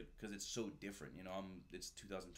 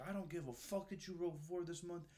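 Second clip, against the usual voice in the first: angry scolding; 9 semitones higher than usual. Retold or imitated. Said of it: "He's like".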